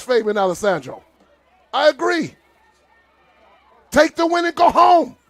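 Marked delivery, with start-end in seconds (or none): 1.7-5.2 real exasperated outburst; indignant. Real, not acted. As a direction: demand they take the win, raised. Siding with coach, indignant.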